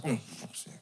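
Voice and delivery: whispered, fed-up mutter; muttered under breath. Retold or imitated. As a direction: grumble it under your breath. Grumbling reply to mum.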